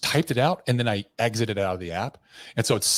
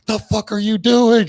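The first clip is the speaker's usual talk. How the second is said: high, loud, drawn-out scream; slow, shrieked demand; 12 semitones higher, 10 dB louder, slower than usual. Retold or imitated. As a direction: imitate her screaming in alarm. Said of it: "She's, like, screaming at me".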